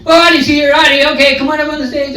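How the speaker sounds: high-pitched imitation of a man calling out from the stage; put-on high host voice, hailing a newcomer; imitating an eager host. Man on stage calling a latecomer up, imitated high host voice.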